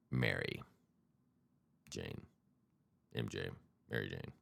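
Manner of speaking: dropped to a near whisper; hushed. Pretend. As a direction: say it softly, conspiratorial. Hushed naming of marijuana.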